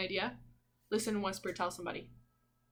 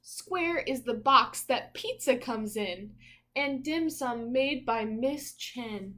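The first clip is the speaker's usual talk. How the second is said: bright, louder picture-book reading voice; reading aloud; 3 semitones higher, 5 dB louder, slower than usual. Acted. Parent reading a picture book to little Zeke, in a rhythmic storybook voice. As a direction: read each rhyme out like a storybook page.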